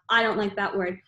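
ordinary delivery, nothing marked

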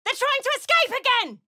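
high urgent yell that they're escaping; alarmed shout. Acted. Companion alerting the party, alarmed high shout. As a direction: shout the warning to the whole party.

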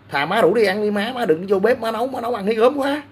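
mock-begging his girlfriend's mom not to cook; mock pleading. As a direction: beg her to stay out of the kitchen, climbing.

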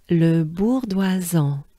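"Le bourdoisan" slowly joined in careful French; slow French model of "Le bourdoisan"; whole name together. Shown as a demonstration. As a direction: join every syllable of "Le bourdoisan", unhurried. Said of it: "as a whole together".

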